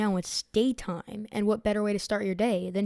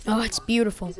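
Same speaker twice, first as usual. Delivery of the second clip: excited; 6 dB louder than usual. Real, not acted. Admiring the finished Seamoth.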